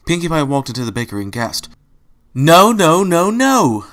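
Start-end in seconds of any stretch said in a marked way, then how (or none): 2.3-3.9 loud, high frantic shouting. Acted. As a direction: cry out in dismay at the mess. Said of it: "she shouted".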